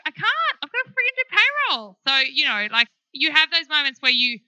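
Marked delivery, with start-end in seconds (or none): 0.0-2.0 high-pitched frustrated retort to husband; to her husband, who says come home, a high exasperated "I can't"; exasperated protest. Retold or imitated. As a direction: whine it out, cornered. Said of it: "And I'm like".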